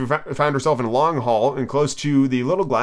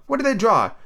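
put-on high girlish question; curious Alice; 10 semitones higher than usual. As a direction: inquisitive little girl. Alice voiced in story.

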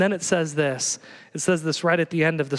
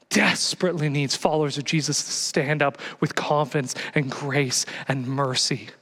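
usual speaking voice

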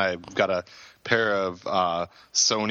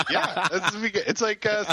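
laughing; 12 semitones higher than usual. Real, not acted. Laughing at jaw joke.

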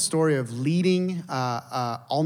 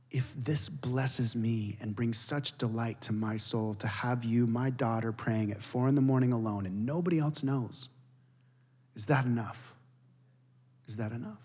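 tender divine voice; 3 semitones lower, 7 dB quieter than usual. Retold or imitated. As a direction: hush it down tenderly, like a loving father. Voicing the Lord, gentle.

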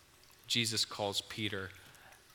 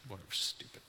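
dismissive; 2 semitones lower, 7 dB quieter, faster than usual. Acted. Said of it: "I'm sure they're just like".

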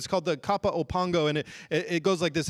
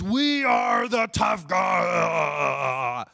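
carried-away roar; 3 dB louder, much slower than usual. Acted. Preacher mimicking self-focused athletes, carried-away drawn-out roar. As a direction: boast dragged out into a long roar. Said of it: "I got a little carried away".

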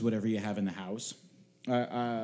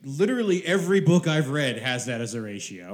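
loud insistence; 3 semitones higher, 9 dB louder than usual. Real, not acted. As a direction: insist hard, raising the voice. Heated martini ratio argument.

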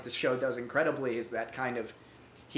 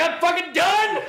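much higher, louder laughing yell; laughing mock outcry; 10 dB louder than usual. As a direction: cry it out, half laughing, totally wrecked. Retelling being emotionally broken.

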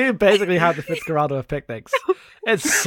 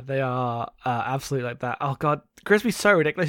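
normal talking voice

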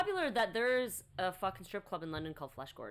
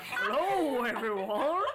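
Mister Ed; 2 semitones higher, 7 dB louder, much slower than usual. Pretend. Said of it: "it's fucking Mister Ed".

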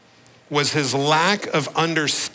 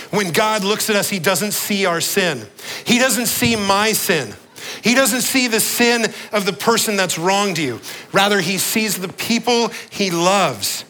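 high, loud, ringing preacher's declaration; full-force preaching; 7 semitones higher, 3 dB louder than usual. Acted. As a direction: proclaim it to the whole church. Preacher builds to climax.

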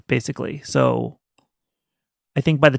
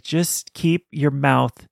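slow self-warning; much slower than usual. Retold or imitated. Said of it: "I'm just like".

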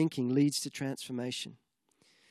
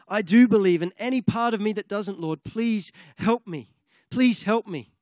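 crying out; 4 semitones higher, 6 dB louder than usual. Retold or imitated. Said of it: "he's crying out".

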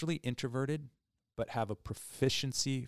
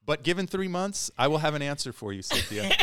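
laughing; 7 semitones higher, 6 dB louder than usual. Pretend. Playful boast with laughing.

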